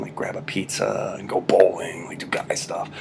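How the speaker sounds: whispered aside about guy stuff; joking whisper. Real, not acted. Teacher whispering a jokey aside to the class. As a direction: whisper it like a shared joke.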